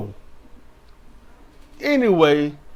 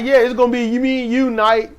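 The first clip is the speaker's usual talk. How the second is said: mock Nakamura; 7 semitones higher than usual. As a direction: lightly imitate Nakamura's promo. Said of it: "Nakamura's like".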